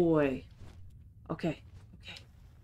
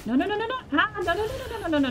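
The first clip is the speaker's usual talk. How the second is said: panicked high no; 12 semitones higher, much faster than usual. Real, not acted. Panicking as a monster approaches.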